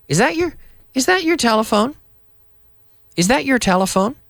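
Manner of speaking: high, quick "Is that your telephone?" with "your" reduced; natural quick question. Shown as a demonstration. Teacher modelling linked natural speech. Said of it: "notice how I don't say your".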